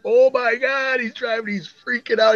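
high-pitched, freaking-out friend; imitated excitement. Retelling how a friend drove off thrilled, excited mimicry. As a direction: gush it as if imitating a thrilled pal. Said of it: "Wally was so excited".

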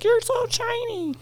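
high, soft squeal of admiration; little girl. Retold or imitated. Little girls following her round the ski slopes, high, soft gushing. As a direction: mimic a small admiring girl in a tiny high voice. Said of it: "the little girls of Girdwood".

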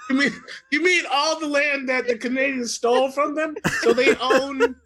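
high voice bubbling with laughter; incredulous and cracking up; laughing. Real, not acted. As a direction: push the question out through laughter. Cracking up over Nunavut.

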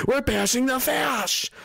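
high, drawn-out mock battle cry; sneering, stretched-out imitation; mocking slogan. Mocks self-styled resistance slogan.

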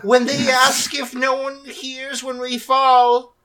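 play-acted solemn grievance; mournful tree voice. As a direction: speak slowly as a wounded tree. Speaking as the falling trees.

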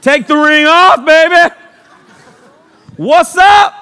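laughing, mocking young guy; excited dude. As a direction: play a guy cheering to ditch his ring. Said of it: "Dudes are like".

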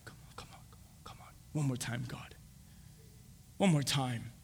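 hushed, low, nearly voiceless begging; whispered plea. Acted. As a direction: plead under your breath with pauses.

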